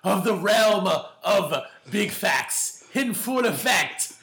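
very slow, pompous lordly introduction; hammy stage actor. Acted. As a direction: proclaim your noble title grandly. Grand self-introduction as a lord.

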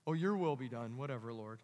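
low, fading offhand prayer; shrugged-off prayer of passive faith; passive resignation. Acted. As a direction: let it drop away, resigned. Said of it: "like a passive faith response".

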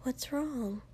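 soft, slightly high worried question; careful and quiet, voice lifted a little; hushed. A narrator's reading. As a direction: ask it gingerly, hushed.

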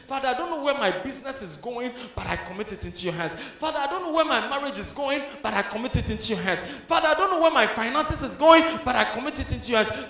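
prayer building from firm to shouted; rising fervent prayer. Acted.

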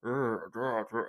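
low muffled villain grumble; dark and muffled. Retold or imitated. Showing Bane in the first trailer, dark muffled grunts. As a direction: mumble darkly as if through a mask. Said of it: "and dark and muffler".

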